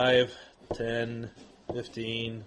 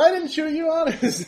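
innocent ogre. Acted. GM voices ogre's innocent denial.

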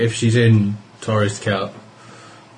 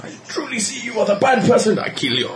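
laughing mock verdict; 10 semitones higher than usual. Mock True Sight verdict, laughing.

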